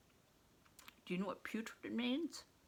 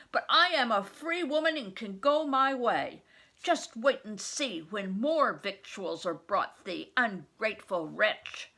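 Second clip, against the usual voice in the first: angry scolding; 4 semitones higher, 9 dB louder than usual. Acted. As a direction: scold the boy, outraged. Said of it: "she cried".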